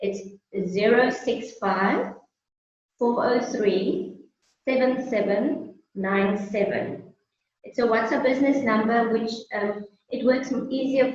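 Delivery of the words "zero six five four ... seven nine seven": slow, digits in small groups with pauses; slow read-out. Real, not acted. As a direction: read the number out slowly for someone writing it down. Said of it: "read it to you slowly".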